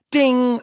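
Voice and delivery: high, ringing bell imitation; elevator ding. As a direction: chime like an elevator arriving.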